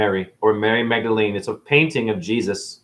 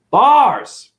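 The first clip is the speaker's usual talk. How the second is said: loud high boast; bragging shout; 12 semitones higher, 7 dB louder than usual. Real, not acted. Self-proclaimed genius brags to the haters. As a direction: crow it like a rapper. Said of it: "I know my shit".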